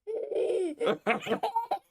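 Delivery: high squealing laugh. Real, not acted. Father laughing with baby, high squealing laugh. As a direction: burst into a high delighted laugh.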